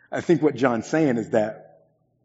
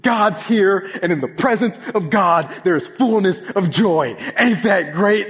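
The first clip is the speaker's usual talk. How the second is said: higher and louder, joyful; imitating Andy; 10 semitones higher, 7 dB louder, slower than usual. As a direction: bubble over like a happy friend. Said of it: "And he says".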